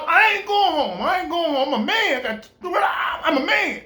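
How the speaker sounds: half-sob, half-yell your manhood; defiant cry. Retold or imitated. Refusing to move back home, a crying defiant shout.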